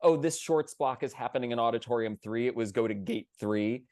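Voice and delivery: ordinary talk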